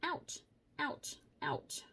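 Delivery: hurt little ouches; quick pained yelps; burnt fingertips. Real, not acted. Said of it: "I just burnt my finger".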